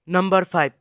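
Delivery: normal talking voice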